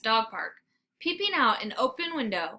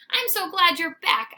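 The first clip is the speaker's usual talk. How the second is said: high, loud happy greeting; happy bark; 7 semitones higher, 6 dB louder than usual. Acted. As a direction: gush with relief. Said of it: "I bark between slurps".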